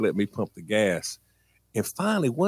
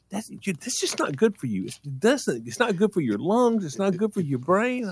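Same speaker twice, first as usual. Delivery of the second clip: lecturing mom voice; 9 semitones higher than usual. Retold or imitated.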